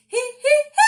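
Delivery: very high, loud hee, top to bottom; high hee sliding down. Shown as a demonstration. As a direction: slide each hee from the top of your voice. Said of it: "we're gonna go right down in pitch".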